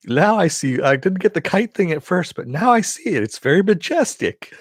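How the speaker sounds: high, awed shark creature voice; King Shark voice. Retold or imitated. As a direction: marvel at it like a dim sea monster. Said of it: "I love King Sharks".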